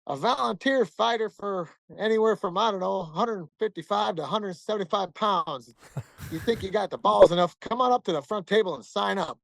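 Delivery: hillbilly emcee hollering for fighters. Retold or imitated. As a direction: call for volunteers in a hillbilly twang. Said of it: "he's got this, you know, hillbilly voice".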